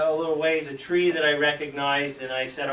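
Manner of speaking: ordinary talk, nothing marked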